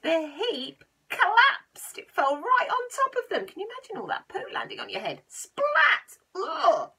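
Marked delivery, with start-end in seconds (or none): 0.0-3.5 dramatic announcement. A narrator's reading. Telling children the muck heap fell, dramatic loud. 5.3-6.9 high, loud splat and groan. Acted. The heap lands on the robbers, loud high splat. Muck landing.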